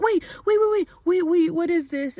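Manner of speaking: high, loud retold panic; panicked protest. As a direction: act out a frantic plea to stop. Acted alarm as pipe comes out.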